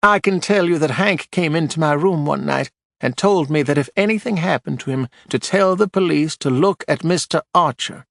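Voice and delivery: a prim widow spreading scandal on the stand; Marjorie, gossipy widow. A narrator's reading.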